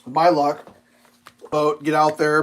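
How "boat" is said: usual speaking voice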